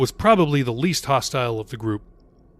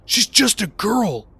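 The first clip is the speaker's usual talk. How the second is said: Jacob's pitying thought; 8 semitones higher, slower than usual. A narrator's reading. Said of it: "Jacob thought".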